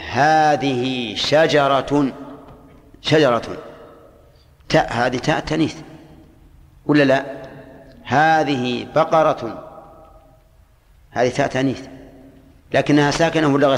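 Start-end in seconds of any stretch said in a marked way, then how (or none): 0.1-2.1 very slow, drawn-out example. Shown as a demonstration. Teacher giving an example word to students, very slow. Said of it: "تقول". 8.0-9.6 very slow, drawn-out example. Shown as a demonstration. Teacher giving an example word to students, very slow. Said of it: "تقول".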